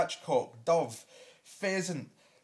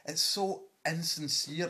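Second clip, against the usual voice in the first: ordinary delivery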